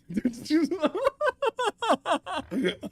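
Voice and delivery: loud, high burst of laughter; laughing fit. Real, not acted. Streamer cracks up practising a greeting, laughing hard. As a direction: crack up in long, high cackles.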